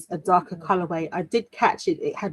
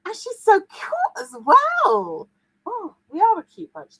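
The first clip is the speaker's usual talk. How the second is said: gushing. Acted. Gushing about cute streamers.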